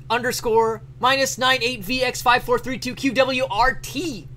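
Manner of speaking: high, loud shouted reading of an all-caps typed letter; yelling the letter. Acted. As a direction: bellow every line as if the typewriter only has capitals. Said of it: "So much yelling".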